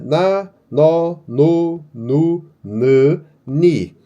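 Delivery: "Na, nó, no, nu" read one by one as clear syllables; reading syllables aloud. Shown as a demonstration. Teacher reads the N syllables aloud to the class. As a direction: sound out each syllable in turn. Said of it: "muito simples de ler isso aqui".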